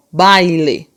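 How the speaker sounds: BAILE slowed so the I sounds out; audible I. Shown as a demonstration. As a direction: draw out BAILE to expose the i glide. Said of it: "você já escuta o i".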